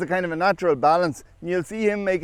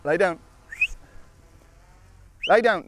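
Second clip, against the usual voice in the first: dog command; 5 semitones higher, 4 dB louder than usual. Real, not acted.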